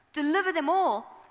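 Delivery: ordinary talk, nothing marked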